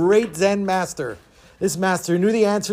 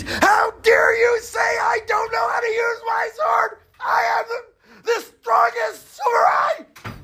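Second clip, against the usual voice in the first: very high, strained samurai screech; enraged scream; slower than usual. Acted. Insulted samurai to the Zen master, screaming with rage. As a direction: scream it drawn out in outrage. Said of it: "gets all red and he screams".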